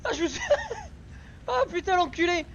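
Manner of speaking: breathless laughing after a scare; nervous laughter. Real, not acted. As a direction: laugh it off, still shaken.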